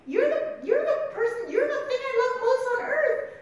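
high-pitched, acted loving protest; Peter. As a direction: plead urgently out of love, playing it up. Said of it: "That's why Peter says this, right? In love".

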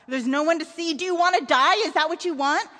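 put-on frightened parent snapping at a child; angry father. A narrator's reading. Frightened father scolds his son.

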